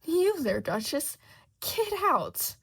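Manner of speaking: high, loud furious shriek; enraged queen ordering Duchess away. A narrator's reading. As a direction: scream her out of the garden. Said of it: "the queen shrieks".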